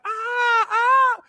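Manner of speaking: very high falsetto mock scream; imitated girls' screaming. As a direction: squeal like a crowd of screaming girls. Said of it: "the girls start screaming like".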